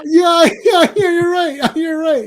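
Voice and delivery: laughing while giving in. Real, not acted. Laughing as he concedes the point to his co-host. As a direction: chuckle through the agreement.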